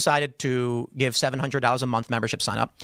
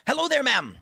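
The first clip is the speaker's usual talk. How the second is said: high, chirpy hail; inviting canvasser greeting; 10 semitones higher than usual. Retold or imitated. Canvasser hailing a woman on the street, eagerly inviting. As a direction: greet a stranger in a raised, inviting voice.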